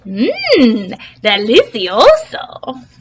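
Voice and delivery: slow, relishing yum; mussel savoring. Acted. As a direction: savor the bite. Mussel eats Plankton.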